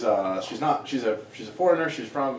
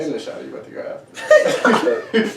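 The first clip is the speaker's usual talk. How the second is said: loud, slow, laughing creepy taunt; creepy menacing; 3 semitones higher, 6 dB louder, slower than usual. Pretend. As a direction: tease like a lurking creep. Playful menacing repeat.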